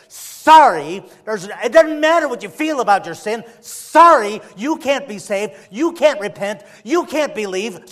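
loud, driving sermon voice; fiery preaching. Acted. Preacher pressing the sinner, at full force. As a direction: preach it hard at the congregation.

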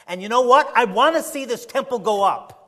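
fervent hopeful preaching; excited push. Real, not acted. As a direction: stir them up with excitement. Preacher excited about building.

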